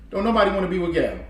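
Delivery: ordinary delivery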